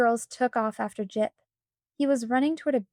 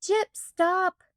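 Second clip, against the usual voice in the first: higher, urgent call of the dog's name; a higher, pleading call to the fleeing dog; shouting after the dog; 8 semitones higher than usual. A narrator's reading. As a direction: call the dog back as it runs off. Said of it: "shouted Samantha".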